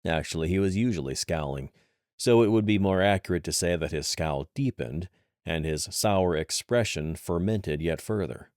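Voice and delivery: ordinary delivery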